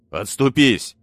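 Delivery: loud stern command; commanding shout. Acted. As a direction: bark it like an order. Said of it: "Я крикнул на беса". (громкий повелительный окрик)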